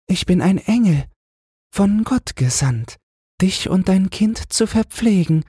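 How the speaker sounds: high, light angelic voice; the angel answers the queen, high and light. A narrator's reading. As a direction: speak as a kindly angel from heaven.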